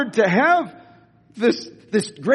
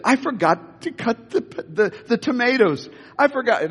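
panicked; 5 semitones higher than usual. Retold or imitated. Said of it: "somebody said".

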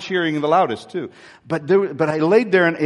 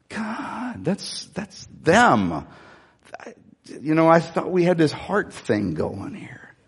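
hurt prayer; slower than usual. Retold or imitated. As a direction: let the voice crack with hurt. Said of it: "What I think is".

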